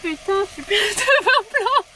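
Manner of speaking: high, loud dismayed outcry; exclamation en riant. Real, not acted. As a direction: cry out at the spill, half laughing. Renverse du vin blanc sur elle. (s'exclamer, catastrophée et hilare)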